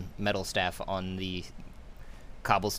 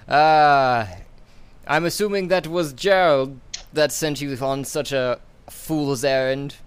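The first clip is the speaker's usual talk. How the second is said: loud, higher, slow mocking villain; 6 semitones higher, 11 dB louder, slower than usual. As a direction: sneer at the fool's errand. DM voices Ferris.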